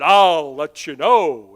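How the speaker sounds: slow, drawn-out loud reply; the Lord answering. Retold or imitated. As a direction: stretch each word like an unhurried divine answer. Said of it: "The Lord said".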